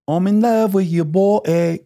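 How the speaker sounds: sing-song badly faked English accent. Host mocking the singer's songs, very bad English accent. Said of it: "I do a very bad English accent".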